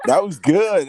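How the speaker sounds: high, loud praise; hyped-up host cheering the singer; excited praise. Real, not acted. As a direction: cheer him on, delighted.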